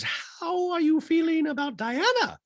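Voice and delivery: slow, high falsetto question; put-on high voice, drawn out; Dorit impression voice. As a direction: stretch it out in mock housewife falsetto.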